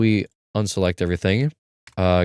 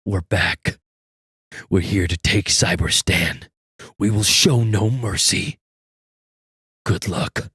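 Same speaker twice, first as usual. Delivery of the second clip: raw robot line, unprocessed; menacing robot soldier; slower than usual. Shown as a demonstration. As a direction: read it like a menacing automaton. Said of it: "let's do something that has to do with that".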